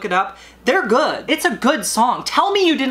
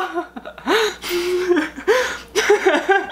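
crazed laugh; 9 semitones higher than usual. Acted. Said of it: "I'm not going crazy".

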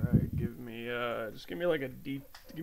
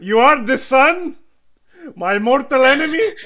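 shocked accusation; 9 semitones higher, 21 dB louder than usual. Acted. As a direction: rise in outrage to each question. Doctor accuses his supposed enemy.

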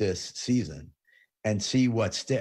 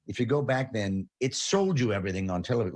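ordinary talk

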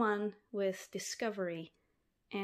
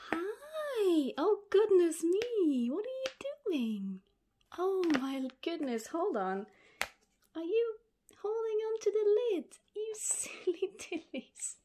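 much higher, doting voice for pets; 10 semitones higher, 4 dB louder than usual. Real, not acted. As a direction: coo at the little snails. Greeting baby snails.

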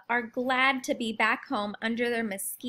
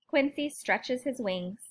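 ordinary delivery, nothing marked

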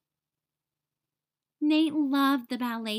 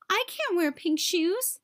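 horrified; 6 semitones higher than usual. A narrator's reading. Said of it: "Nate was horrified".

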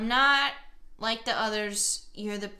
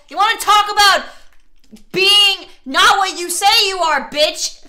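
angry; 7 semitones higher, 13 dB louder than usual. Real, not acted. Player confronting the game partner about lying, angry. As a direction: snap into an angry yell. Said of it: "Sorry, I'm getting angry".

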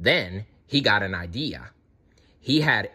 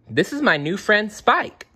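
put-on high, eager young dinosaur voice; Ducky; 11 semitones higher, 3 dB louder than usual. A narrator's reading. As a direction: introduce them proudly, pitched up. Ducky introduces new friend.

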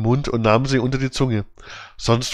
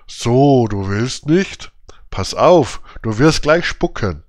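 menacing robber; 3 dB louder, slower than usual. A narrator's reading. Räuber droht Bengele.

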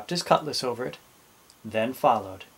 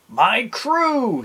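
high, loud exclamation; delighted captain; 10 semitones higher, 6 dB louder than usual. A narrator's reading. Captain spotting his pirates climbing down, excited. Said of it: "he thought".

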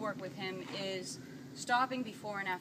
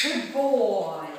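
praising a dog; 10 dB louder than usual. Real, not acted.

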